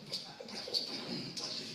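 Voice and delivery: whispered urging. Retold or imitated. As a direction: hiss it secretly to a friend. Pedro susurra a Juan. (susurro de complicidad)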